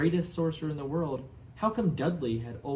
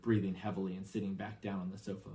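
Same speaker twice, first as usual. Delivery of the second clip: ordinary delivery, nothing marked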